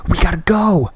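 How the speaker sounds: high, urgent order; urgent shout. Acted. Christy ordering Graham out of the wrecked truck, urgent. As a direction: push him out of the truck with your voice.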